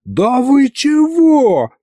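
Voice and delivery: high, drawn-out cry of disbelief; outraged yell. A narrator's reading. Husband outraged at meddling sorcerers, mild yell. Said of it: "заорал уже и я".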